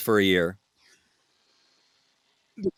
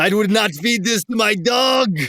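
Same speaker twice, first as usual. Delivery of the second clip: indignant French shout; 7 semitones higher than usual. Retold or imitated. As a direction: shout it like an offended Parisian. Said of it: "You sound like a pretty good Frenchman".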